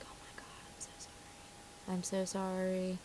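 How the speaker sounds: slow, hushed apology; mortified whisper. Acted. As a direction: whisper it as if embarrassed for mom. Embarrassed daughter apologising under her breath.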